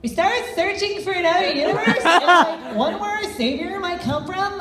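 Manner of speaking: high, giggly new Mew voice. Acted. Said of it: "they got someone totally different".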